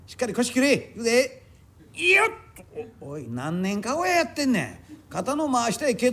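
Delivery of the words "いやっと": sharp high heave as the load goes up; lifting grunt. Acted. Palanquin bearer heaves the load up: high strained grunt. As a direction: grunt as you hoist a heavy palanquin.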